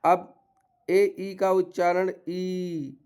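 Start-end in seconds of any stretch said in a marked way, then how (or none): 1.2-1.4 ई drawn out as a long vowel. Shown as a demonstration. Teacher showing learners the long vowel, lengthened ई. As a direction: hold the ई sound longer. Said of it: "थोड़ा लंबा उच्चारण होगा इसका".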